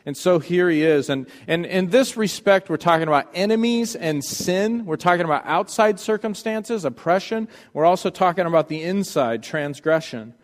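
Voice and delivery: usual speaking voice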